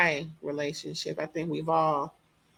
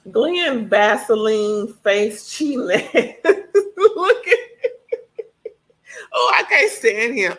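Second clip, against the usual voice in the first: laughing; 4 semitones higher, 7 dB louder than usual. Real, not acted. Laughing rant at cheating husband.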